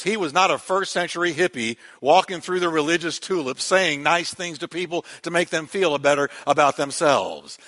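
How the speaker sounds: ordinary talk, nothing marked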